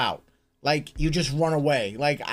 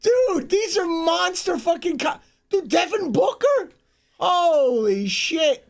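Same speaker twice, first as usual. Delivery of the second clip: excited disbelief; 11 semitones higher, slower than usual. Real, not acted. Reacting to huge contracts.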